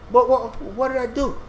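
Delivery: high, whiny mock dismay; fake hurt innocence. David feigning hurt to Achish, high mock innocence. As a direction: play wounded innocence you don't feel. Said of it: "he was pretending like he was upset".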